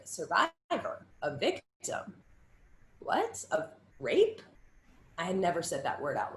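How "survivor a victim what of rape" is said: rising, halting, shocked questions; stunned disbelief. Retold or imitated. Young survivor hearing the word from her mentor, stunned. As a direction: repeat the words as if they can't be true. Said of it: "And I was like".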